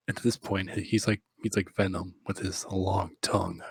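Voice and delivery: usual speaking voice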